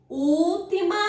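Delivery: normal talking voice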